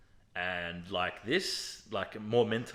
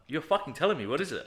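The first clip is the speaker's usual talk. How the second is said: higher-pitched, insistent retold demand; 6 semitones higher, 3 dB louder than usual. Patient retelling his demand to the sonographer, insistent. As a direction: press for an answer, mildly worked up.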